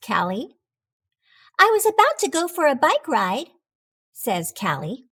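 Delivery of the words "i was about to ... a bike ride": Callie's voice. Acted. Callie declines.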